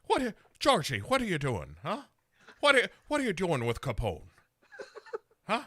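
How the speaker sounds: comic Irish cop grilling Georgie, hammy brogue; Irish beat cop. Retold or imitated. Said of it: "the Irish beat cop about her".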